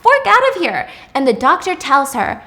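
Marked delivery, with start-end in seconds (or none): 0.0-0.9 high, louder mock-angry retort; upset retort. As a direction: snap it back at him, half-acted. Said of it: "And she's upset".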